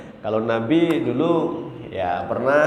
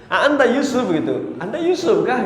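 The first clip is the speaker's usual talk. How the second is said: astonished recognition; 10 semitones higher, 3 dB louder than usual. Retold or imitated. Brothers recognising Yusuf, astonished.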